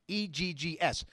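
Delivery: normal talking voice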